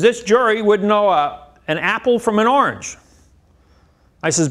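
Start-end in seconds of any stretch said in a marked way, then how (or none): 0.0-3.0 retold emphatic argument. Acted argument about the jury.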